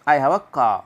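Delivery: "car" ending in "kaa", no R; silent R. Shown as a demonstration. Teacher shows how it is said otherwise, silent R. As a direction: drop the final R of "car". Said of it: "बाकी बोलेंगे".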